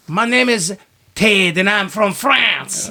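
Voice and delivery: high, slowing, over-the-top French accent; ridiculous cartoon French accent. Shown as a demonstration. Clowning for a friend as a Frenchman, cartoon French accent. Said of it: "like, ridiculous cartoon version".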